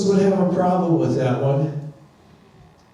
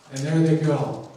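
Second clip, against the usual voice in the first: usual speaking voice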